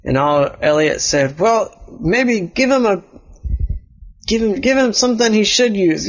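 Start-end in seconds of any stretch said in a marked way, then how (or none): 1.4-6.1 put-on higher boyish character voice; Elliot's voice. Elliot suggesting help, higher kid voice.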